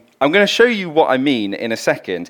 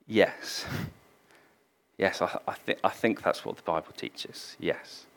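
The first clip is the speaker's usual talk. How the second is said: softer, half-whispered, fading admission; sheepish quiet yes; 6 semitones lower, 15 dB quieter than usual. Retold or imitated. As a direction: admit it quietly, cornered. Bill cornered, admitting belief.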